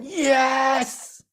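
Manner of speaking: drawn-out, very high yes; ecstatic cheer. Acted. Buddhas cheering you a year later, ecstatic. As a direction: whoop with acted delight. Acts cheering the ball picked up.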